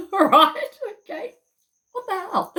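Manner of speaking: high, laughing disbelief; incredulous laughing. Real, not acted. Laughing at shopkeepers' absurd claims.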